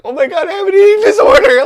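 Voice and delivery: very high, loud mock-panicked squeal; hammed-up shrieking panic; freaking out. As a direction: squeal it in exaggerated alarm. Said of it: "I'm freaking out".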